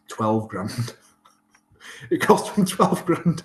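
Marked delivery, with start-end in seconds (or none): 2.1-3.4 high, slow, laughing; amused disbelief. Real, not acted. Telling the host about the bill, laughing. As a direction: say it chuckling at the absurd bill.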